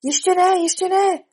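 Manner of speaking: high, urgent cutting-in; brisk interruption. Acted. Rabbit interrupts the king's verdict call, brisk and insistent. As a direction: cut in firmly, pitched high and loud. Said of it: "skočil mu rázně do řeči králík".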